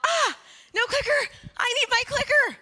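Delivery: high, loud mock panic over a lost clicker. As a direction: wail it like a panicked child. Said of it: "And I'm all".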